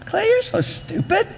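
very high mock scolding; exasperated potter. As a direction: potter telling off the clay in a high voice. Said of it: "what Lisa would say to the clay".

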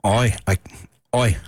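lightly attempted British "oi"; British accent. Shown as a demonstration. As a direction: try a British accent, tentatively. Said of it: "Can you do a British accent?".

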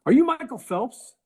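high, loud, incredulous question; excited retold question, voice jumping high; curious fan. Stranger quizzing undercover Eli, retold.

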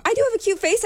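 high coy boast; playful high-pitched brag. Real, not acted. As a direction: tease yourself about your face. Joking self-praise, voice jumps high.

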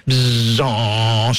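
put-on a child's airplane buzz, lips vibrating; toy plane buzz.

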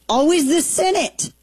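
sarcastic sigh of a line; exasperated. Real, not acted. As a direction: pitch jumps way up in mock despair. Exasperated dig at Senate.

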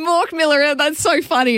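high-pitched, delighted retold call; amused exclamation. Mum filming her daughter in the box, amused exclamation.

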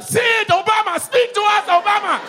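high, loud chanting call. Retold or imitated. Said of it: "Kenyans be like".

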